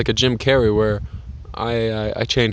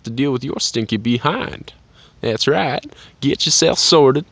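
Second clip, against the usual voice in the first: folksy put-on accent, words stretched out; put-on drawling accent. Man alone outdoors urging viewers to sort themselves out, put-on accent. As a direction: play up a put-on country accent. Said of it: "this is not my native accent".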